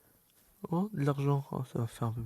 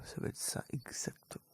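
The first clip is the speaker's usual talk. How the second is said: hushed aside; whispered; 12 dB quieter than usual. Real, not acted.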